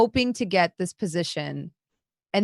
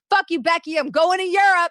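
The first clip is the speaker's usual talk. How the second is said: higher, louder mock outburst at Becky; mock angry retort; 10 semitones higher, 5 dB louder than usual. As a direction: spit it out like a jilted lover.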